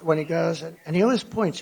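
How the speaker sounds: ordinary talk, nothing marked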